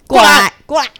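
high, loud mock yell; shouting mother. Mother yelling at her child to come over. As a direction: call the kid sharply, shouting. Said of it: "你不要再说".

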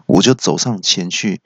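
ordinary delivery

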